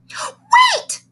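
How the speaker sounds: very high shrieked "Wait!"; a little girl shrieking in alarm. Acted. Girl shrieks to stop the new boy, very high. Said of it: "shrieked the little girl".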